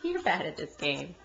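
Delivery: low, soft, dry teasing; mock-disappointed aside; deadpan tease. Real, not acted. Teasing the toddler.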